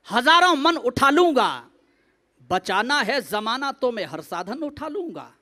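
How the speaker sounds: declaimed verse with pauses between lines; mushaira-style delivery; poetry recitation. Acted. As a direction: recite to a crowd, dropping on the closing line. Recites four-line verse.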